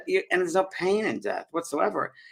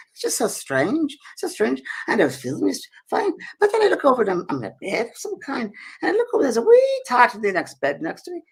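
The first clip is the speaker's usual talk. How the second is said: high, swooping Scottish storytelling; Scottish accent; 10 semitones higher, 4 dB louder than usual. Retold or imitated. Scottish lady recounts waking in a strange bed, high Scottish accent. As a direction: play the puzzled Scottish lady, pitched high. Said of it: "I'm trying to my Scottish accent".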